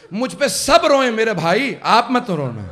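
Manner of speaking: pleading lament. Retold or imitated. Dying Hasan pleads with brother.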